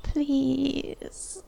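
drawn-out, pleading please; begging. Acted. Woman pleading with a hesitant lover, begging. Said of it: "I beg".

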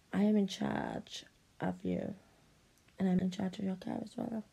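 ordinary delivery

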